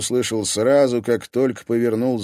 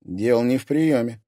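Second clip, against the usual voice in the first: ordinary delivery, nothing marked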